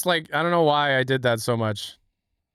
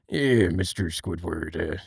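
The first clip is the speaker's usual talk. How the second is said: serious-talk Mr. Krabs impression; low, hushed Mr. Krabs being earnest; serious Mister Krabs; 7 semitones lower, slower than usual. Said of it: "doing a bit where Mister Krabs".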